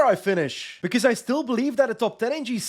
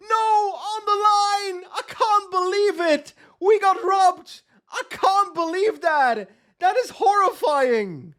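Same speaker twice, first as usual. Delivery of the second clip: stunned disbelief; 7 semitones higher, slower than usual. Real, not acted. Stage win lost on the line.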